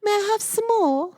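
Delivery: high, pleading mock Cockney urchin. Friend joins the Cockney boy joke, mock Cockney plea. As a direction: beg like a Cockney orphan, hammed up. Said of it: "has a Cockney accent".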